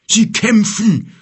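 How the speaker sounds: breathless, slow, loud announcement; excited snort. Acted. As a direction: burst out with urgent news. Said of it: "schnaubte er aufgeregt".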